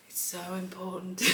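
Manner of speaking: very soft, hushed guide voice; soft mock sleep meditation; imitated meditation guide. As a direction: intone it quietly, breaking into a laugh. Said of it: "and she says".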